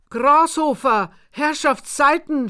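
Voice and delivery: high, loud yell after him; shouting. A narrator's reading. Narrator yells after the running farmer, loud and high. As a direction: bellow his name across the field. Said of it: "schrie ich".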